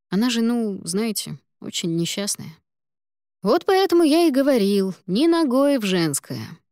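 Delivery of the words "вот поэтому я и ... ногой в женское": put-on lecturing older colleague.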